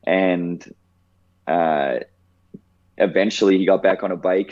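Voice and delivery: usual speaking voice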